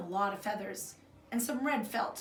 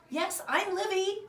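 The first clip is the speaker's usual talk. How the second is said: over-eager reply; 11 semitones higher, 4 dB louder, slower than usual. Acted. Nervous girl distracting visitors.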